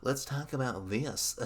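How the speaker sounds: a cartoonish Southern goof, like Goofy. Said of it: "I don't know what voice that was".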